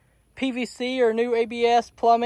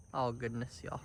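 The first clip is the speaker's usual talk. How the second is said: normal talking voice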